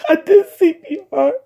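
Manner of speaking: real tears, pushing words through them; crying. Real, not acted. Recalling her brother's death, speaking through tears. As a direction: voice breaking high as she recounts it.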